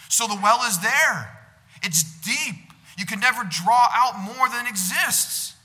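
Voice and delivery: loud, rising sermon voice, stretching key words; full-force preaching. Acted. Preaching the love of God.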